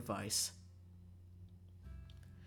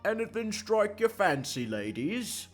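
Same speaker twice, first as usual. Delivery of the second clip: high, loud vendor's sales pitch; stallholder's voice; 9 semitones higher, 8 dB louder, slower than usual. Acted. Cupcake seller greets two customers, in a stallholder's voice. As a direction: call out to customers in a put-on high voice.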